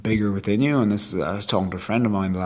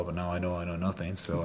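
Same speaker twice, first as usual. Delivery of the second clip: usual speaking voice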